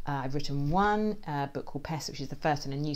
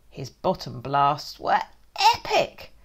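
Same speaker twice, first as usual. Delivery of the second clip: ordinary delivery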